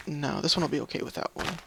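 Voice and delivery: usual speaking voice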